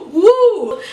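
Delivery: loud high whoop; a gleeful, loud woo; excited whoop. Real, not acted. Cheers after laughing.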